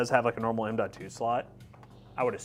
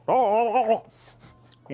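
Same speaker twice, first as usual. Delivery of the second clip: mock panicked oh-oh-oh; 10 semitones higher, 9 dB louder, much slower than usual. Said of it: "you made that exact noise".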